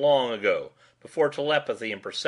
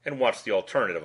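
usual speaking voice

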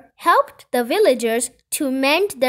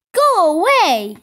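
raised, higher villagers' yell; 5 semitones higher, 7 dB louder than usual. A narrator's reading. Villagers drive Kipper away, yelling. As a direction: shout to drive someone off. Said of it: "yelled the people".

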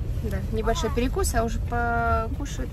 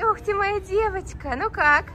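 excited; 10 semitones higher than usual. Real, not acted. Praising the child after ride.

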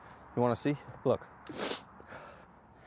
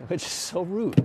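mock offended; 8 semitones higher, 8 dB louder, slower than usual. Real, not acted. Reacting to viewers' accusation.

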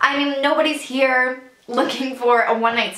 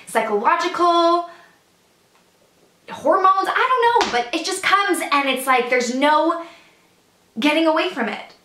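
higher-pitched, building agitation; agitated outpouring; 4 semitones higher than usual. Acted. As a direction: spill it out as if it takes you over. Monologue builds about an uncontrollable episode.

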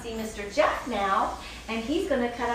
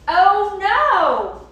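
usual speaking voice

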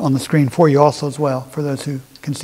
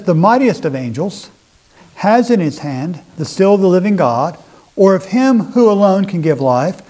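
solemn, measured Bible reading, a touch louder; scripture reading; 2 semitones higher, 5 dB louder than usual. Acted. Preacher reads Ezekiel to the congregation, solemn and measured. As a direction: read the verses out with weight.

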